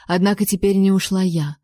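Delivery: ordinary delivery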